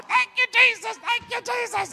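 high, loud, overjoyed sobbing praise; joyful old lady. Retold or imitated. Old lady in the parking lot praising Jesus, high and tearful. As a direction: cry out thanks for paid bills.

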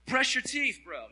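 mock blunt advice. Imagined blunt line to the kid.

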